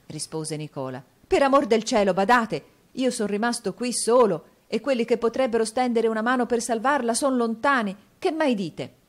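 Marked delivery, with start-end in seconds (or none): 1.3-8.9 loud, high, agitated plea; distraught appeal. A narrator's reading. Nicola alone, her helpers far away, distraught appeal. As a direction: implore him in rising desperation. Desperate appeal at terrible news.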